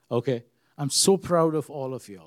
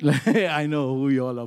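laughing; 4 dB louder than usual. Real, not acted. Laughs after slip.

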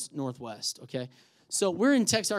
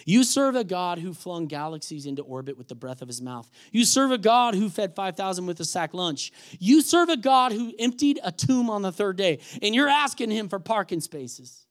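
building preaching; 2 semitones higher, 6 dB louder than usual. Real, not acted. Preacher challenging church about small prayers, building intensity. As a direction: build each line toward a forceful peak.

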